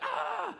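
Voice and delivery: sudden high yelp of fear; mock scared shriek; frightened yelp. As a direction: yelp like the wave scares you. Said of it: "some people would be like".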